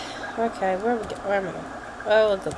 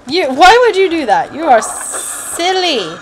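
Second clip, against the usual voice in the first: mock scolding; 7 semitones higher, 5 dB louder than usual. Scolding the game character.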